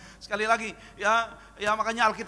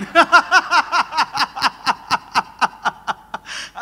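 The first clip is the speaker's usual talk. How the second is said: 1 loud laugh; 4 semitones higher, 9 dB louder than usual. Real, not acted. Laughing at own joke.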